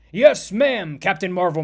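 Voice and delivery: loud, keen "yes ma'am"; eager recruit. Acted. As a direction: answer your hero like a starstruck cadet. Eager recruit answers his hero's order.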